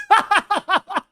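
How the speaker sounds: high burst of laughter. Real, not acted. Loud laugh after quote.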